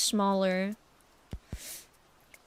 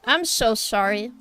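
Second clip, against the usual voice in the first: ordinary delivery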